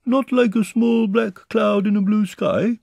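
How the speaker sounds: high, slow, hopeful question; anxious bear. A narrator's reading. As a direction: call it down with mild worry. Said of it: "said Pooh anxiously".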